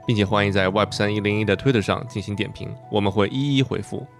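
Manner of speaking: upbeat announcer read over music; podcast outro read. Acted. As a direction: read the show's sign-off promo. (播客结尾宣传播报)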